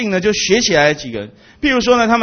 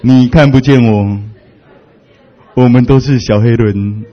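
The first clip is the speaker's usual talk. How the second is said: slow, louder, spelled-out words; slow playful line; 7 semitones lower, 4 dB louder, slower than usual. Shown as a demonstration. Preacher giving the congregation a line to say, slow and louder. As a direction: say it slowly to your neighbour. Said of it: "跟你旁边人讲说".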